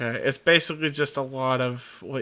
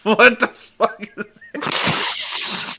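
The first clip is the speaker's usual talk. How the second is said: slow, high, loud stretched disbelief; drawn out shriek; 5 semitones higher, 10 dB louder, much slower than usual. Real, not acted. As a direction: drag it out in loud shock. Repeats drawn out.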